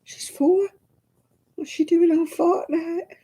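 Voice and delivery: high-pitched disbelieving questions; incredulous. Retold or imitated. Great-grandmother hearing a four-year-old plays Fortnite, incredulous. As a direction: question it in mock astonishment, voice way up. Retold disbelief at child's claim.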